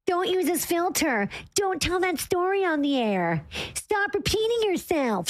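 mock bossy wife. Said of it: "quoting her wife's orders".

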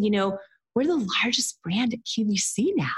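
hushed whisper of disbelief; breathless whispered aside. Retold or imitated. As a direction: whisper it like a secret. Whispered in retold call.

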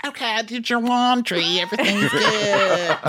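high, laughing sweet voice; Brittany impression. Friend continues Brittany impression, high and laughing.

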